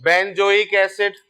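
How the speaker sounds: drawn-out, syllable by syllable; slow dictation. Shown as a demonstration. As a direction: say the name slowly for students to write. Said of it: "teacher spelling out the name".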